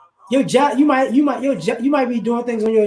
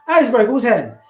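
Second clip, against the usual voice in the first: ordinary delivery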